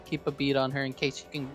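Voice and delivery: normal talking voice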